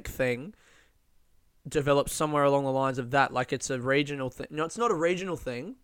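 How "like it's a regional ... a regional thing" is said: usual speaking voice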